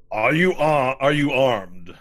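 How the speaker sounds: loud, high stern guard; stern guard challenge. Acted. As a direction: bark the challenge at the stranger. Lizard guards challenge newcomer.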